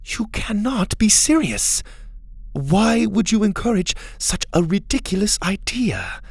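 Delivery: high, deliberate scolding; incredulous reproach. A narrator's reading. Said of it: "Mora turned on him".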